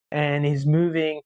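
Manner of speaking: ordinary talk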